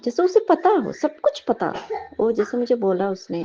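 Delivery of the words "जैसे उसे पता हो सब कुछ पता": amazed laugh. Real, not acted. Amazed at child's knowledge.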